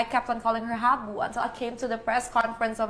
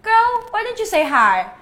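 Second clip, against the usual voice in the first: high, louder, drawn-out callout; mock confrontation; 9 semitones higher, 5 dB louder than usual. Tired contestant to a girl walking past, mock confrontation. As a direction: call her out slowly, pitched up.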